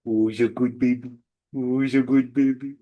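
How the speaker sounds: put-on lightly cooing to a baby; baby talk. Shown as a demonstration. Parent picking up a crying baby, lightly cooing baby talk. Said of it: "it's literally called baby talk".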